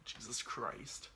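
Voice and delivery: soft, slow whispered exclamation; whispered aside. Real, not acted. Vlogger whispering at himself, embarrassed. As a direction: mutter it under your breath.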